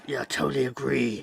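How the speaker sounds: low gravelly dry calm; an eighties action-film soldier, deadpan; grizzled war veteran. Shown as a demonstration. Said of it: "grizzled veteran in nineteen eighties war flick".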